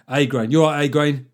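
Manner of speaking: loud matey "You all right, A Grain?"; mock pub greeting. Host greets a new patron like a mate, loud and matey.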